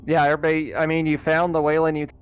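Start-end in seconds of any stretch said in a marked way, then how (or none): none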